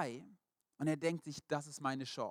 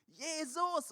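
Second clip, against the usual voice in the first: desperate shout; 13 semitones higher than usual. Retold or imitated. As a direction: cry out with everything left. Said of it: "fängt noch lauter an zu schreien".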